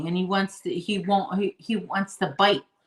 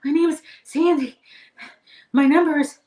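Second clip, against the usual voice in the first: higher, louder rushed reply; flustered on the phone; 9 semitones higher, 8 dB louder than usual. Retold or imitated. Giving the agent her details while chasing the puppy, higher and louder. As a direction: give your name while chasing a puppy. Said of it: "I'm telling her my name".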